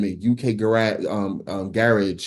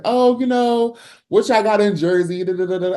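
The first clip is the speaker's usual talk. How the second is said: higher-pitched mock of the doubters; dismissive mimicry; 11 semitones higher than usual. Artist imitating people who doubt Jersey, dismissive mimicry. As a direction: imitate everybody's skeptical question, pitched up.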